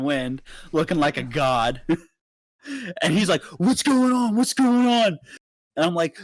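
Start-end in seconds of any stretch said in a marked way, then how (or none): 3.6-5.2 high, louder retold urgency; mock breathless concern; imitated alarmed asking. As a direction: burst in demanding answers. Friend rushing out alarmed.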